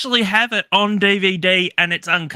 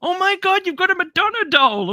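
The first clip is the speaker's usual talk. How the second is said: high, gushing amazed friend; friend's amazed voice. Retold or imitated. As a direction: squeal it like a stunned friend. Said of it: "He was like".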